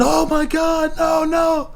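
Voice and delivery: very high, loud anguished cry; mock sung-out shriek of no; imagined yell. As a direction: wail it out as the helpless husband. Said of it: "Or he just was just yelling".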